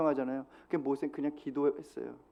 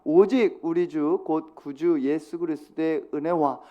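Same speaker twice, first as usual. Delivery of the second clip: 느리고 또박또박 읽는 성경 봉독; 회중과 함께 천천히 말씀을 읽듯이; 5 dB louder, slower than usual. Acted. Reads scripture aloud together. (회중과 함께 성경을 천천히 또박또박 낭독)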